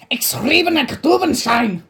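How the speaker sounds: loud, high fake German gibberish; angry mock German. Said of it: "I said it angrily, so it sounded German".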